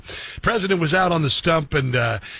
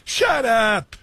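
shouted dismissal; 5 semitones higher than usual. Real, not acted. Scoffing at Democrats' claims.

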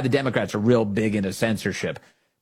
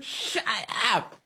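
mock scolding, softened and drawn out; Nancy Pelosi; 11 semitones higher, 5 dB quieter, slower than usual. Host mimicking a politician refusing questions, mock scolding. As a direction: imitate a politician's shut up, under full volume. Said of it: "That was Nancy Pelosi's version of that".

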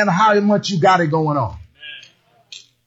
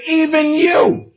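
high, slow "Even you" with a rise; pointing it out; 9 semitones higher, slower than usual. Shown as a demonstration. Congregation told to say it to a neighbor, high and slow. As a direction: lean into "Even" and lift "you". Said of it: "Look at your neighbor, say".

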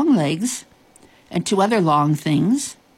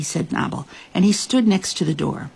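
usual speaking voice

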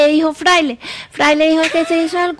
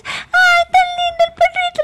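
much higher squeaky cooing voice; baby talk to dog; 14 semitones higher than usual. Retold or imitated. Co-host mocks how he talks to her dog, high-pitched baby coo. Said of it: "si tú le hablas así".

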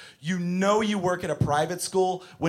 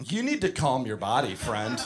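restrained, lower and softer teacher voice; calm preschool teacher; 5 semitones lower, 5 dB quieter than usual. Retold or imitated. Teacher calming a screaming child, low restrained patience. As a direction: keep a cool head with an unruly child. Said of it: "I'm just like".

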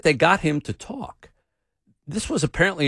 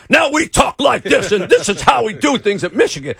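much higher and louder, puffed-up proud voice; pompous Michigan man; 8 semitones higher, 6 dB louder than usual. Retold or imitated. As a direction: play the grand old Michigan coach, chest out. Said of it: "Everything we associate with Michigan is".

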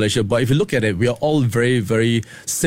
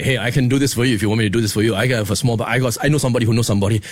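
fast, low wheeler-dealer patter; smooth-talking fixer pitching his connections; fixer Smile; 4 semitones lower, faster than usual. Shown as a demonstration. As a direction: rattle it off like a shady fixer. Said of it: "there was Smile".

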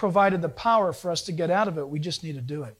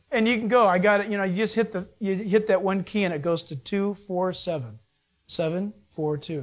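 ordinary delivery

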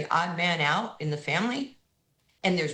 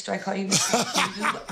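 laughing; 3 semitones higher, faster than usual. Retold or imitated. Joking with [name], laughing.

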